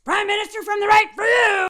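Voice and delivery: sudden high mock yell; mock shout. Mocking the defeated politician, a hammed-up shout. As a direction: bellow it like a sore loser. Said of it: "he was like".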